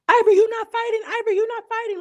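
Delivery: high mocking imitation of Anna. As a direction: squeal it out like a taunting castmate. Anna mocking Ivory in recap.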